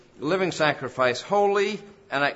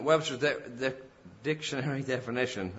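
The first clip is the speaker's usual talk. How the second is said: tongue-tied stumble; 5 dB quieter than usual. Real, not acted. Preacher fumbles the dictionary's name while quoting it, tongue-tied. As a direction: stumble on the first syllable, then laugh. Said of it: "get my tongue twisted there".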